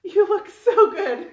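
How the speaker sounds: gushing at the face on the shirt; laughing gush. Real, not acted. Admiring friend's face on shirt.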